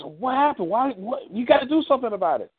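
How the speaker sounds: high, urgent wife voice; demanding wife. Acted. Wife demands husband do something, in high, urgent protest.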